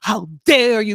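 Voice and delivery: hammed-up indignant outburst, high and loud; upset. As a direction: gasp it out in mock outrage. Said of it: "like, upset".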